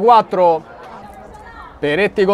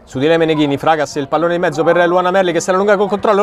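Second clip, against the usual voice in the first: ordinary talk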